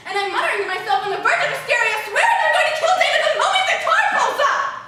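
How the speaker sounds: loud, high, rising into a frantic near-yell; a frazzled woman boiling over, half laughing; hysterical rant. Retold or imitated. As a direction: work yourself up to the edge of hysteria. Building panic in the lobby.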